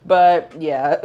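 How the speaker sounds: loud laugh breaking the words. Real, not acted. To viewers, laughing off the bad day.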